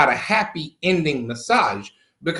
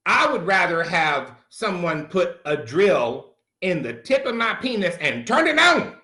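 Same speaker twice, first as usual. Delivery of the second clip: ordinary talk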